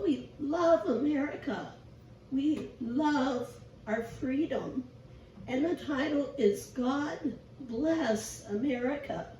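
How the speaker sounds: normal talking voice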